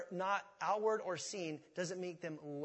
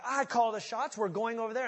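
bossy head; 5 semitones higher, 7 dB louder than usual. Retold or imitated.

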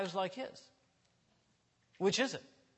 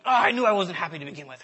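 exasperated outburst; 13 dB louder, faster than usual. Retold or imitated. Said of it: "And I'm like".

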